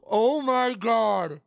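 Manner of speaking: high and very slow, real disbelief; stunned oh my god. Real, not acted. Streamer stunned by the rare pull. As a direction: drag it out, stunned.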